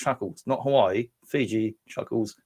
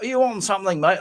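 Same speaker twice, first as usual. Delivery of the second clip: high-pitched mock copper's question; imitated police officer; 9 semitones higher, faster than usual. Copper questions him at a breath test, mock suspicion. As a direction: challenge a driver, pitched right up.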